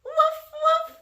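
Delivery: high-pitched little woofs; short, high puppyish barks; dog woof. Acted. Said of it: "Can you woof like a dog?".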